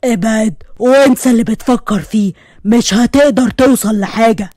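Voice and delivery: altered, menacing voice on the phone; thick inhuman voice. Acted. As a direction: warn him off in an unearthly voice. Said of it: "الصوت اتحول وبقى صوت تخين". (صوت تخين مش بني آدم)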